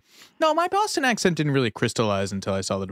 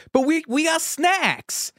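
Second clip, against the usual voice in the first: Casey Affleck impression; slower than usual. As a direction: squeal it like a kid defending the snacks. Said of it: "he just goes".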